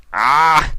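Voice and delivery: rising gasp before a sneeze; sneeze build-up. A narrator's reading. Discord about to sneeze at the table, gasping. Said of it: "felt a sneeze coming".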